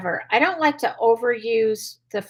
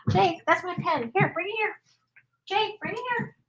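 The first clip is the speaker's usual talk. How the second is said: coaxing the dog, voice lifted well higher; sweet high call to get the pen back; 8 semitones higher, 6 dB quieter than usual. Real, not acted. Trainer coaxing her dog to return the pen, high and gentle.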